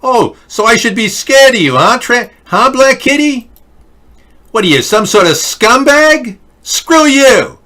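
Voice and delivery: high, loud mocking rant; angry. Real, not acted. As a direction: tell him off loud and high. Telling off a chatter.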